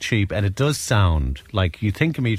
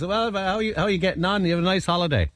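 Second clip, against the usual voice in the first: higher, sing-song hello to the silent man; chirpy over-friendly hello; bright cheery greeting; 8 semitones higher than usual. Retold or imitated. Greeting the grumpy English fella at the bar, cheerily higher.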